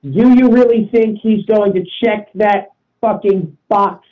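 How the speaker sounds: ordinary talk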